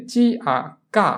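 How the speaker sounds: চি আ, then কা with hard k; spelled-out syllable. Shown as a demonstration. Teacher shows students C plus A, spelled out into কা. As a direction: spell চি আ and blend into কা. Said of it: "তাহলে হবে".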